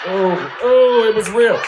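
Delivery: loud, high exclamation; sudden loud high exclamation; loud shout. Real, not acted. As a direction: shout it out in shock. Shocked exclamation.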